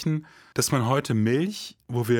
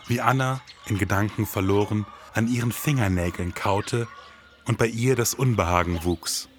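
low, brooding crime-story narration; moody voiceover over music; noir detective narration; 4 semitones lower than usual. A narrator's reading. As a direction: tell it like a hard-boiled detective.

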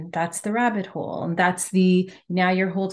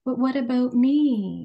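slow tearful plea, higher; small inner voice; 5 semitones higher, 3 dB louder, slower than usual. Retold or imitated. Her inner voice at the candle event, tearful plea. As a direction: plead like a hurt child. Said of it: "I heard this voice within say".

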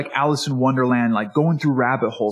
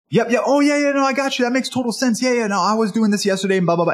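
high, rushed run of agreement; high-pitched mock listener, tumbling into blah-blah; mock eager interrupter; 9 semitones higher, faster than usual. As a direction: imitate someone jumping in to talk about themselves. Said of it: "that person's gonna say".

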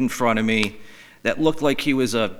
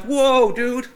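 startled whoa; 11 semitones higher, 3 dB louder than usual. Retold or imitated. Alarmed reaction to biker.

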